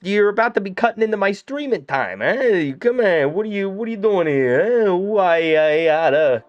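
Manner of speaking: put-on high, loud cranky old-timer voice; grumpy cartoon threat. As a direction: shake a fist at the screen in a cartoon voice. Mock threatening Twitch while loading.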